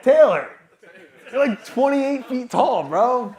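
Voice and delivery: high, shouted mock astonishment; loud amazement. Meeting a Zoom colleague who turns out tall, amazed shout. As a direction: call out in hammed-up disbelief, stretched slow.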